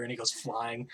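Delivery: ordinary talk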